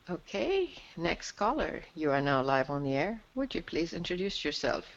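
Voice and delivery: call-in host inviting the next caller to speak, announcer style; radio host announce. Acted. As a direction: introduce the next caller to the line.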